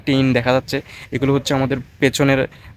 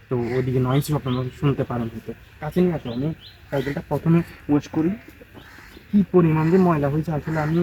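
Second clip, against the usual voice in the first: normal talking voice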